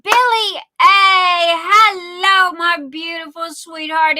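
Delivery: loud, high, drawn-out hello; excited shouted greeting. Real, not acted. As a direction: call out to a beloved friend arriving. Calling out greeting to a friend.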